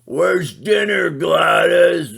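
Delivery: loud, high, slow bellowing demand; a loud hammed-up lazy husband. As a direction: holler for dinner like a slob. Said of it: "and go".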